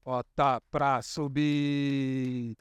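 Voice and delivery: low, very slow Bota pra subir. Shown as a demonstration. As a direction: start the climb low and slow. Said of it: "é só fazer o que eu faço".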